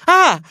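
high, loud fright yelp; boy touched by an unseen hand, screaming in fright; startled scream. Acted. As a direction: jump out of your skin. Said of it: "schrie er".

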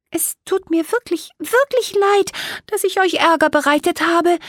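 usual speaking voice

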